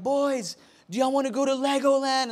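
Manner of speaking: high, quick imitation of a mom; wife to boys. Wife offering the boys a trip, imitated excited mom. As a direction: pitch it up like mom offering a treat. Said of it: "and she was like".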